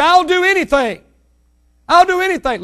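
high, loud pleading cry; desperate plea. Retold or imitated. Parent pleading to save their child, high and loud. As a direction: beg like a frantic parent.

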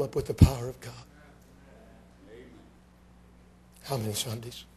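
low, soft, slowed wonder; preacher recalling God's power in a near whisper; hushed reverence. Acted. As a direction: let it drop to an awed hush. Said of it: "hushed reverent recollection".

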